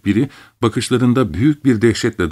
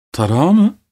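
incredulous; 7 semitones higher, 3 dB louder than usual. A narrator's reading. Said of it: "diye sordum".

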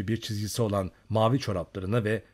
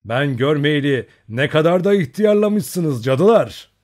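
louder, higher, slow playful jibe; loud teasing; 5 semitones higher, 9 dB louder than usual. A narrator's reading. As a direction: tease them loudly, grinning. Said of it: "Gülmeye başladı". (kızlara yüksek sesle, takılarak)

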